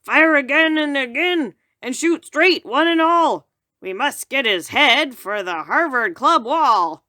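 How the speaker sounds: loud, high commanding hunter; rally the shooters loudly; hunter calling out. Acted. Hunter calls his men to shoot the moose, loud command. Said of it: "heard a voice call".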